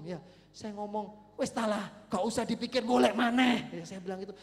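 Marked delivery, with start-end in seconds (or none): 1.4-3.7 loud retold brush-off; brash dismissive advice. Counselor tells heartbroken teen to move on, loud and brash. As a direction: wave the worry away at full volume.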